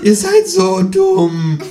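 very high, slow, drawn-out kangaroo sneer. A narrator's reading. Kangaroo tells humans they are stupid, slow and high. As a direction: stretch each word in a shrill kangaroo register.

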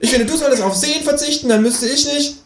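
very high creature voice; bickering kangaroo. A narrator's reading. Kangaroo quarrelling with the narrator, very high. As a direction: argue back in a high animal voice.

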